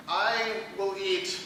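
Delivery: usual speaking voice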